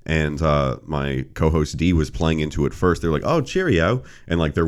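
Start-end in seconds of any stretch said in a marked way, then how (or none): 3.2-4.0 high, sing-song mock British greeting; British imitation. Co-host playing along with the British guy, mock British accent. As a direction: put on a playful British accent, pitched up. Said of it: "way better at, you know, imitations".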